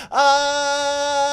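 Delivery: high loud charging yell; a running battle cry. Acted. Ed running toward the water, high loud yell.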